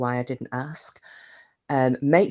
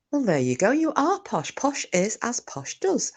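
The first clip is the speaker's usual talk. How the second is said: higher lilting mock posh voice; 9 semitones higher than usual. Mock posh, teasing the viewer Marie. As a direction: tease in a plummy tone.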